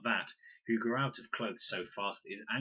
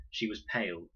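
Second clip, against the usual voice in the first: usual speaking voice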